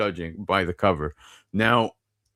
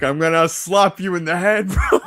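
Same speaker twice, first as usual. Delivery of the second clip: laughing threat; 7 semitones higher, 6 dB louder than usual. Retold or imitated. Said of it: "I'm like".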